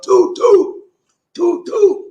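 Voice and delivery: high, loud toot toot; train whistle toot. Shown as a demonstration. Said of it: "when I say toot".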